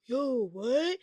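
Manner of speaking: a quiet puzzled mock "what"; mocking confused whisper. Mocking the confused moviegoers, hushed. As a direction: imitate the confused couple, hushed. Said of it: "y'all talking about".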